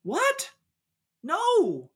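very high, incredulous squeal; outraged disbelief. Real, not acted. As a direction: insist on it as if scandalized. Outraged by the Sideshow Bob guess.

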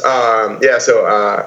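normal talking voice

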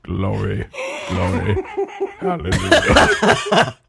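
lightly voiced gospel shout, slow hallelujah; mock church praise. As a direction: ham it up like a revival preacher.

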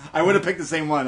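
laughing through the words. Real, not acted. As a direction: still giggling while talking. Laughing at friend's bathroom joke.

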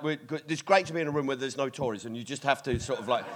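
comic aside to the front rows; quiet aside. Real, not acted. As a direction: drop the voice and rush it.

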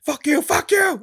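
loud, very high imitated outburst; shouted retort. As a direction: yell it as the insulted man in the retelling. Acted outburst of insulted confessor.

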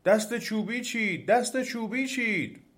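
high, drawn-out alarm cry; trees shouting together. A narrator's reading. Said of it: "همه با هم فریاد زدند".

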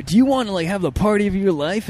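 high, pumped-up ad announcer; commercial voice. Pretend. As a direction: sell the party like a beer ad. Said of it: "That's a good voice".

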